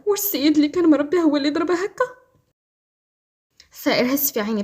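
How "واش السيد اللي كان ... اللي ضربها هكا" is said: higher, rising question; raised-pitch questioning read. A narrator's reading. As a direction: ask with disbelief, lifting toward the end. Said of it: "ليلى بتساؤل".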